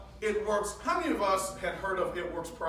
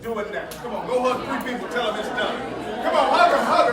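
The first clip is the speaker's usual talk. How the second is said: loud, high urging over a noisy crowd; rallying shout; 6 semitones higher, 11 dB louder than usual. Real, not acted. Speaker sending the crowd off to hug, rallying shout. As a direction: call it out like a rally leader.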